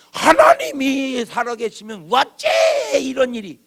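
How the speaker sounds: loud, slow, pleading outcry; anguished complaint. Retold or imitated. People seeing the world's troubles, crying out loudly to God. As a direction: shout it up at heaven in protest.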